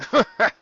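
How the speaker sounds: a quick high laugh, falling off; Reckoning laughing at the little dragon; short laugh. Acted. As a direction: burst out laughing in disbelief. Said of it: "Reckoning laughed".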